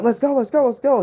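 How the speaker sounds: high, urgent repeated plea; imitated panic. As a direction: mimic a frantic buddy begging to leave. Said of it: "He's screaming".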